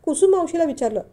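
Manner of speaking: plain reading voice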